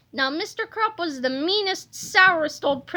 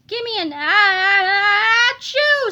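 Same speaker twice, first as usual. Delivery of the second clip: higher, louder ah-ah building to achoo; building sneeze; 5 semitones higher, 6 dB louder than usual. Acted. Cheerleader breaking off the chant into a louder building sneeze. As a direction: let the sneeze swell and burst. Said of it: "sneezed the cheerleaders".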